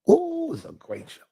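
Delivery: normal talking voice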